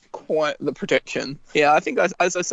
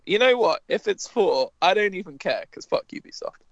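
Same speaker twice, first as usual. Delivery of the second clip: ordinary delivery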